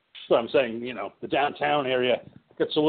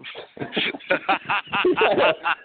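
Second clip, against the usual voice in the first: laughing; 7 semitones higher than usual. Real, not acted. Laugh at guests' addition.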